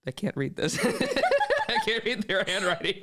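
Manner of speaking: breaking up into high laughter; laughing. Real, not acted. Host cracks up over guest's handwriting, laughing. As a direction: lose it laughing as you speak.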